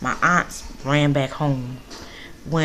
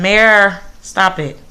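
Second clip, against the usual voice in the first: scolding; 3 semitones higher, 9 dB louder, slower than usual. Real, not acted. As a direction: stop him mid-mischief. Scolding the kid mid-video.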